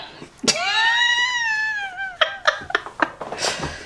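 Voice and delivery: long, very high emotional squeal; high squealing cry. Real, not acted. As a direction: let out a drawn-out high squeal. Overcome by the reading.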